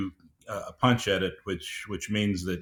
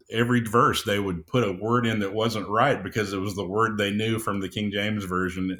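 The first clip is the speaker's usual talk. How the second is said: usual speaking voice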